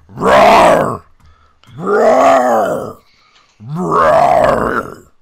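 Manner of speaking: loud, hammy lion roaring. Acted. GM voicing a distant lion, loud hammy roar. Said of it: "It's the lion".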